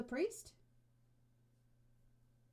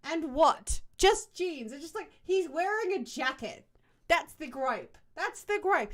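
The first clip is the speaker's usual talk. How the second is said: loud, raised-pitch incredulity; exasperated disbelief; 5 semitones higher, 8 dB louder, slower than usual. Real, not acted. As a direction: protest each point loudly.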